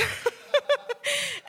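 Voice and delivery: high falling laugh. Real, not acted. Laughing at being so deluded.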